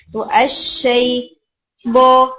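slow اشئی, then بو hit hard and loud; strong articulation demo. Shown as a demonstration. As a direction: punch بو with a firm hit, no air. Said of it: "اس کا ہٹ strong کریں".

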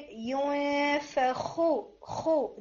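ینفخ slowly with a rasping throat kha; teacher stressing kha; clear kha demo. Shown as a demonstration. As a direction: give the kha in ینفخ time. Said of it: "جو خا کی sound ہے".

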